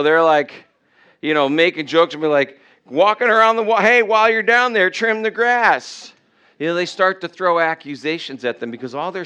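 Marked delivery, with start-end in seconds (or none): 2.8-6.1 loud, high taunt called down from a wall; mocking jeer. Jericho's people on the wall making jokes at the marchers, mocking shout. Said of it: "they start to mock 'em".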